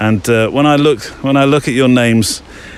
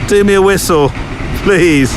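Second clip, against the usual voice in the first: calling out; 8 semitones higher, 3 dB louder than usual. Real, not acted. Calling out to train again.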